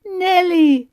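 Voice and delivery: high, loud and helpless, drawn out slowly; helpless cry. Retold or imitated. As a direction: wail her name, unable to help. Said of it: "said poor Kate helplessly".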